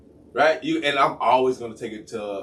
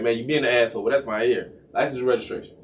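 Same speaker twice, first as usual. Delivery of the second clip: usual speaking voice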